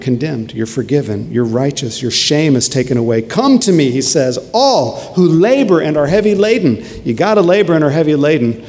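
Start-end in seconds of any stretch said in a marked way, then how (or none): 3.2-3.9 high, emphatic call of invitation; impassioned preaching. Acted. Preacher quoting Jesus inviting the burdened, impassioned. 4.5-8.6 high, emphatic call of invitation; impassioned preaching. Acted. Preacher quoting Jesus inviting the burdened, impassioned.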